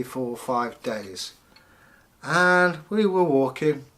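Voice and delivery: plain reading voice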